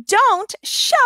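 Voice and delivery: ordinary delivery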